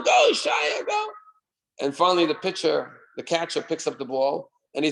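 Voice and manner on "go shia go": high excited cheer; cheering shout. Retold or imitated. Everyone cheering Shaya's run, high shouted cheer. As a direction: yell him on toward first base.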